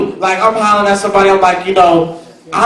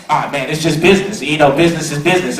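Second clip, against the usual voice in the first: lower, fast dismissive brush-off; mocking business people; 6 semitones lower, 4 dB quieter, faster than usual. Mocking cold business types to the crowd, fast and low. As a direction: toss it off like a cynic, quick and low. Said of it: "they're like".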